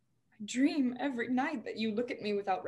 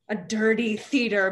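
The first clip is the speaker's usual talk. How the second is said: ordinary delivery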